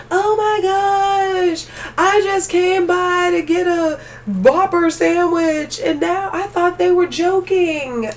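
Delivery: high, slow, sing-song gushing; mocking commercial winner. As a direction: parody a breathless giveaway winner. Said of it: "people on this commercial going through the drive-thru".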